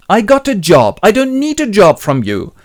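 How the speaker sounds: loud, high-pitched refusal; acted indignation. Willie refuses his neighbor's job offer, offended.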